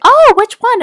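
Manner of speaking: very high, loud squeal of interest; excited curiosity. Retold or imitated. Tech assistant hearing patient is in a sorority, excited. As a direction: imitate a thrilled, eager question.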